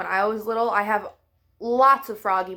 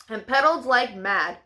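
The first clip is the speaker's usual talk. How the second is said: ordinary delivery, nothing marked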